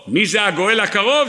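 usual speaking voice

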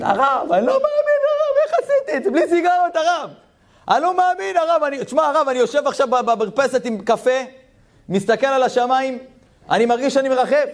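very high, laughing disbelief; ecstatic laughing voice. Retold or imitated. Man thanking the rabbi after his Shabbat, laughing high delight. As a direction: overflow with joy and wonder.

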